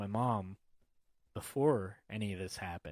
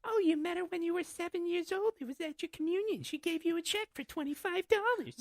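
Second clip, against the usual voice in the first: gossipy mom. Retold or imitated. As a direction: mimic mom eagerly spreading news, pitched way up. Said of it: "And she'd be like".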